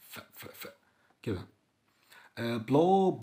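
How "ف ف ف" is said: ف- as a soft unvoiced breath; breathy f demo; voiceless f sound. Shown as a demonstration. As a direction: blow ف- through teeth on lip, no voice. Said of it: "خلي أسنانك الفوقانية على شفتك التحتانية".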